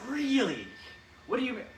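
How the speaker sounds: high, loud incredulous gasp; shocked villain. Acted. Cobra Commander reacting to the allergy plan, high and shocked. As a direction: act stunned by the answer.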